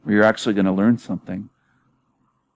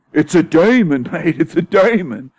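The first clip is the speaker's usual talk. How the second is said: high, mocking British-accent cry about a demon; mock British accusers; 7 semitones higher than usual. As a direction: parody a scandalised Brit, pitch jumping up. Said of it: "they could see that and they'd say".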